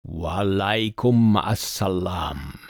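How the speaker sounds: slow, deep, resonant djinn; deep-voiced spirit's solemn greeting. A narrator's reading. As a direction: draw the greeting out slowly in a deep voice. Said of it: "replied in a deep voice".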